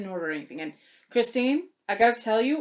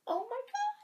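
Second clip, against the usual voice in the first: much higher, breathy awe; awed gasp; 14 semitones higher, 9 dB quieter than usual. Real, not acted. Vlogger overwhelmed by the gift, awed oh my God.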